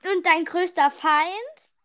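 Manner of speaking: ordinary talk, nothing marked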